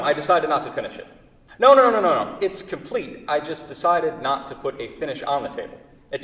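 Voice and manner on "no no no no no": rising, louder string of nos; emphatic no. Acted. Refusing the buyer on the phone, loud and emphatic. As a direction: cut him off, refusing hard.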